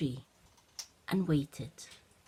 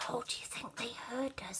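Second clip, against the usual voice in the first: fast anxious unvoiced whisper; worried whisper; 5 semitones higher, 10 dB quieter, faster than usual. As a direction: whisper it nervously. Olivia worried, hiding.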